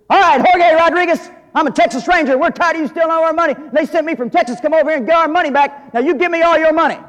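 high, loud, fast lawman demand; Texas Ranger shout. Retold or imitated. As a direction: bark it like a tough ranger storming in. Joke: ranger confronts outlaw in cantina.

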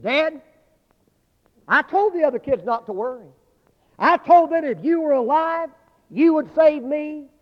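put-on the boy bursts out his trust; excited child. Rescued boy tells his dad he trusted him, high and loud.

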